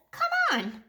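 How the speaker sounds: high, eager call to his brother. Acted. Stanley urging Arthur to fly him, eager and high. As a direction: urge him on brightly.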